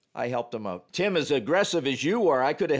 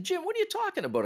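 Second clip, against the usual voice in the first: surprised retort; faster than usual. Retold or imitated. Referee's startled reply retold.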